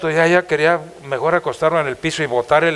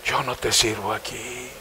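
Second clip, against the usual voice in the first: quiet lament; 7 semitones lower, 12 dB quieter, slower than usual. Retold or imitated. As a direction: lower and much softer complaint to God. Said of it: "Y decía". (lamento en voz baja a Dios)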